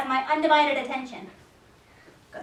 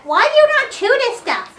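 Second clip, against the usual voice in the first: playful scolding; 10 semitones higher, 6 dB louder than usual. Real, not acted. Mock scolding the puppy.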